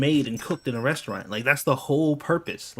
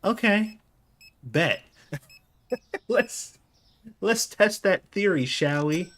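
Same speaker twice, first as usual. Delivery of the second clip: higher, laughing dare; mock challenge; 7 semitones higher, slower than usual. Host calling out the owner about garbage beans, higher playful dare. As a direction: call her bluff with a grin. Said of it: "And he was just like".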